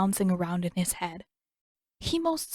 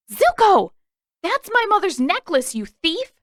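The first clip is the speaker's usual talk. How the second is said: high, loud furious yell; 11 semitones higher, 6 dB louder than usual. Acted. Katara yelling after Zuko about her mother's necklace, loud. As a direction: scream his name, then accuse him. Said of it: "yell at him again".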